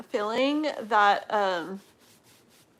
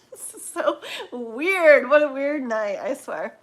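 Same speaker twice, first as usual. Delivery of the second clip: teary laugh; 4 semitones higher, 9 dB louder than usual. Real, not acted. Overwhelmed reader laughing at herself.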